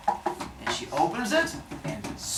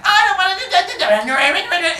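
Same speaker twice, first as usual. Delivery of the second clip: high, garbled ranting yell; mock hysterical shouting; yelling in his face; 11 dB louder than usual. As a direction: screech a jumbled tirade, hammed up. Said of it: "starts yelling and getting right up".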